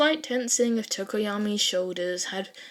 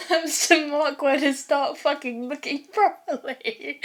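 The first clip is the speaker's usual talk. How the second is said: laughing; 6 semitones higher, 7 dB louder than usual. Real, not acted. Reader laughing at own slip.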